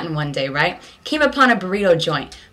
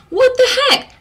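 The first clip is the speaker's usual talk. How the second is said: very high, loud disbelief; shocked outrage; 5 dB louder than usual. Retold or imitated. Watching the girl cart-jack the peddler, high shocked squeal. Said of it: "And I'm like".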